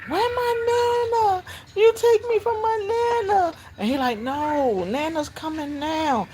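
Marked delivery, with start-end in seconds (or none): 0.1-3.5 high wailing tantrum, imitated; mock tearful kid's protest, very high; screaming, yelling child. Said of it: "She's screaming and yelling". 4.2-6.3 placating. Retold or imitated. Caseworker placates screaming child, retold.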